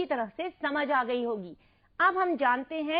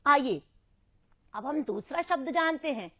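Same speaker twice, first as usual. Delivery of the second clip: ordinary delivery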